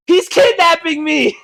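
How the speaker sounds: high, loud, slow yell; mock alarm scream. Kid crying out before the neighbors, mock-terrified shout. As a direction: holler it out for everyone to hear. Retold kid's false cry for help.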